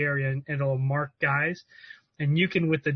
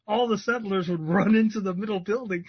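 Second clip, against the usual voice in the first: usual speaking voice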